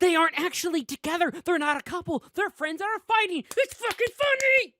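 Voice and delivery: high, loud, giddy outburst; excited rant. Real, not acted. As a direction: shout it through rising glee. Gushing about the couples therapy joke.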